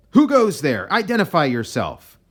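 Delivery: shouted high and loud like a guard on watch; sentry's challenge. Acted. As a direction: bark out the challenge into the dark. Sentry challenges an approaching shape.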